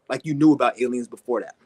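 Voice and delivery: usual speaking voice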